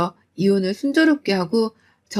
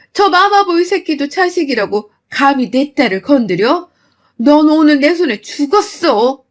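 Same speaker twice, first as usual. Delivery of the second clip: furious yelling; 3 semitones higher, 5 dB louder than usual. Retold or imitated. Mother yelling at the mother-in-law who hit her daughter, furious. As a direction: tear into her at full volume.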